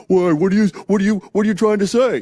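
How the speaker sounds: high, loud, rapid defensive challenge; acting out an indignant, rattled comeback; defensive retort. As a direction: fire back as if accused, voice jumping up. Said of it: "it's not self-defense".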